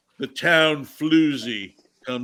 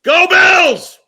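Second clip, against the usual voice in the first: big Go Bills yell, much higher and louder; all-out fan shout; full shout; 14 dB louder than usual. Shown as a demonstration. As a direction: scream it like at the stadium.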